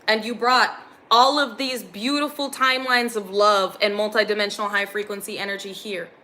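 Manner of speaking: usual speaking voice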